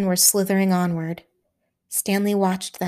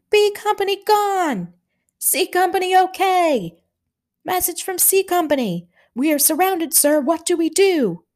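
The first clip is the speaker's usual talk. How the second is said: much higher, yelled report; frantic shout; 11 semitones higher than usual. A narrator's reading. Signal station shouting losses in the pandemonium, frantic yell. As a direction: cry out the loss in panic.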